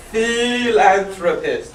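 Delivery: "Philanthropist" said slowly and clearly, stress on LAN; slow clear model word; slow pronunciation. Shown as a demonstration. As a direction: carefully model "Philanthropist" for the class. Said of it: "P H I".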